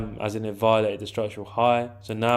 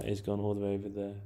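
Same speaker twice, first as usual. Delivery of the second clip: ordinary talk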